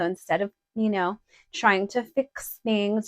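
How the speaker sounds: ordinary delivery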